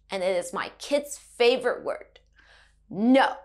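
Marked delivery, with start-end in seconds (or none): 2.9-3.4 NO falling firmly, louder; a loud, firm NO that drops at the end. Shown as a demonstration. Teacher quoting kid's favorite word, falling NO. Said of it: "we have something similar in English".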